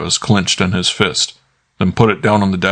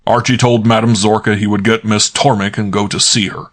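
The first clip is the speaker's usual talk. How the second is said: plain reading voice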